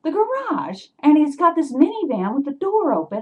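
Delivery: high, loud outraged retelling; worked-up, incredulous storytelling; indignant. Retold or imitated. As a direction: relive the outrage, voice jumping up. Reliving the break-in indignantly.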